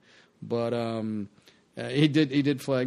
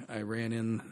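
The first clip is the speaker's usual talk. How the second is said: usual speaking voice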